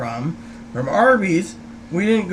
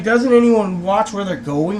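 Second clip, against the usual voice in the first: usual speaking voice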